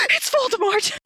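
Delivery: high, gasping mock realisation. A reader mocking Ebony spotting Voldemort, high gasping surprise. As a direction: gasp it out like a cartoon reveal.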